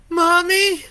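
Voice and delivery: put-on high, loud child's shriek; terrified scream. A narrator's reading. Boy seeing his mother turn monstrous, terrified shriek. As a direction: scream for your mother in terror.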